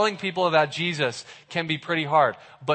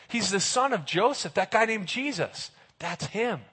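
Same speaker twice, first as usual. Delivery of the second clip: higher, hushed and eager; excited Philip; 4 semitones higher than usual. Retold or imitated. Philip tells his best friend Nathanael, excited and higher. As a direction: tell the big news, bursting inside. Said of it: "So he's so excited".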